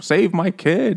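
high-pitched mock plea; imitated desperate mom. Mom begs the rescuer for her kid, mock desperate cry. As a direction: play a mother crying out, lightly hammed up. Mom pleads for drowning child.

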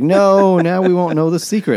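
high, loud hammed-up wail; hammed-up shocked whine; mock dismayed cry. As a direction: wail it like a cartoon loser. Character's dismay quote.

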